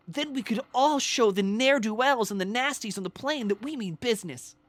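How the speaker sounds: rally the passengers like a cheesy hero; wholesome hero voice. Acted. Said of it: "Anytime I talk like that".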